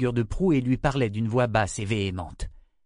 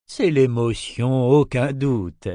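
fussily self-important; 5 dB louder, slower than usual. Acted. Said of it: "tout affairé".

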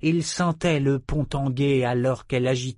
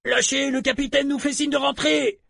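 calling up from below. Acted. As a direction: shout up to the deck to fall back. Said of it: "montaient les appels de leurs camarades".

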